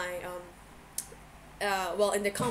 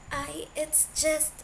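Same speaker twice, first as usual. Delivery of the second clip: nervous gulping; 7 semitones higher, 3 dB louder, slower than usual. A narrator's reading. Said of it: "he gulped loudly".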